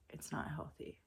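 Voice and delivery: breathy whispered repeat. Shown as a demonstration. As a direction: drop to a whisper to drive it home. Repeats phrase, then whispers it.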